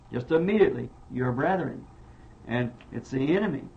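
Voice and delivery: ordinary talk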